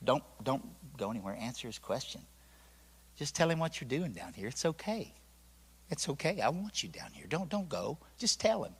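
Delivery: very soft, tender reassurance; soft, caring. Retold or imitated. God reassuring a scared boy, soft and caring. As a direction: gently coax someone to stay. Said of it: "It was so soft and so beautiful and so caring".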